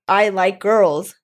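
slow, drawn-out confession; retold firm restatement. Coming out to mom again after her question, slow and deliberate. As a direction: spell it out slowly for someone who didn't get it.

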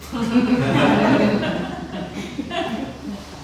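a long high laugh at her own joke. Real, not acted. Laughing at her own answer to herself, high laugh. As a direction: let a high laugh roll out.

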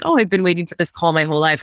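ordinary talk

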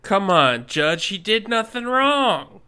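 pleading protest. Acted. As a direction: argue the soldier's innocence. Player protests to the judge.

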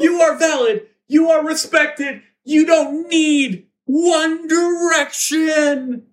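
high, sustained proclamation, slowing at the end; mock yell. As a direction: chant affirmations like a rally broadcast.

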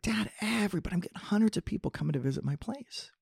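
high, soft mock-excited child; excited kid. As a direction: imitate a thrilled son telling dad, hushed and high. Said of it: "He's really excited".

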